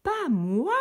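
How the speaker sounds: un refus miaulé, aigu; chat qui miaule. A narrator's reading. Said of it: "miaula le chat". (le chat au soleil refuse, miaulement aigu)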